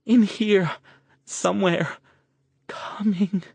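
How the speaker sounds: high, frightened whimpering warning; scared. Acted. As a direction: sense something coming and dread it. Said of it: "She sounded scared".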